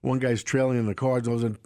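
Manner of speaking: ordinary talk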